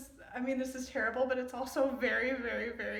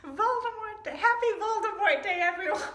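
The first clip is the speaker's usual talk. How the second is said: high, loud, laughing festive greeting; mock cheer; 10 semitones higher, 9 dB louder than usual. Real, not acted. Reader laughingly wishing everyone a happy Voldemort Day. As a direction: wish everyone a happy holiday in jest. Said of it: "mock holiday greeting".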